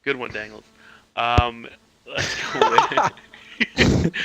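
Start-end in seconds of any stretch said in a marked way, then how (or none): 2.1-4.3 laughing. Real, not acted. Slow laughing high drawl.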